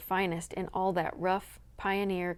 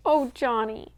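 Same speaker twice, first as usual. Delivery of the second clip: high, loud emotional cry; 4 semitones higher, 5 dB louder than usual. Acted. Wife falling into her husband's arms, emotional cry. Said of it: "she cried and buried her head".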